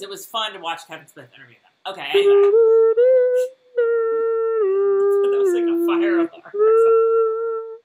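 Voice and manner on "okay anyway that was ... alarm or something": high, loud sung alarm wail; silly loud siren noise; mock siren.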